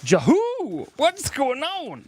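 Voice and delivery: high, loud whoop, drawn out; whooping catchphrase. Pretend. Streamer acting out what the J stands for, whooping. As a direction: holler it like a silly catchphrase. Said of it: "J stands for".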